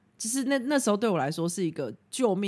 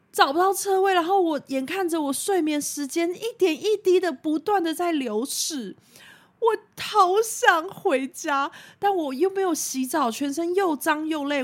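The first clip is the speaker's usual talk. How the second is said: whiny exhaustion; 9 semitones higher, 3 dB louder than usual. Retold or imitated. Reliving exhausted night hunting parking.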